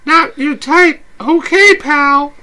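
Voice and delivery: playful date voice, high and bright; high girly voice. Acted. S0 holds Abby voice.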